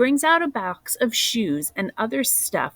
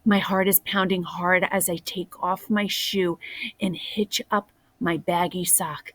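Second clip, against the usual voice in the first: usual speaking voice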